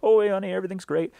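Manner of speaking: high, fast, sing-song "everything's great"; mock nonchalant. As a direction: pretend all is fine while chaos hits. On phone amid zombie swarm.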